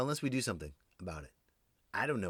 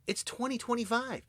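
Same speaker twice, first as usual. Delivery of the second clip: incredulous protest; 12 semitones higher than usual. Acted. Acting incredulous reply to hushed answers.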